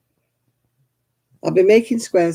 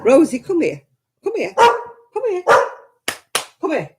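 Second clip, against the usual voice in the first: high coaxing calls; calling the dog; 9 semitones higher than usual. Real, not acted. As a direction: call her over in a high coaxing voice.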